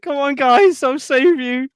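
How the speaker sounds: high, loud heroic call; heroic rescuer voice. Pretend. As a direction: cheer on the troops, high and loud. Playing heroic survivor.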